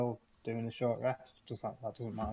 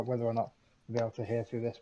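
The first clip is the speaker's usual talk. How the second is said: ordinary talk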